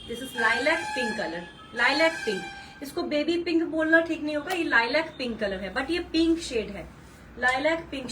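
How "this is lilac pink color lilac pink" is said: slow colour emphasis. Shown as a demonstration. Announcing colour in English.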